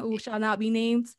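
ordinary talk

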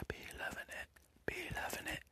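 soft whispered tease. Acted. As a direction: breathe it out. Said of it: "whispered repeat".

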